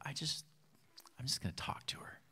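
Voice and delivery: whispered inner thought to himself; hushed thought. Retold or imitated. Friend quietly deciding to talk to neighbor, whispered. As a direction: hush it like a private decision.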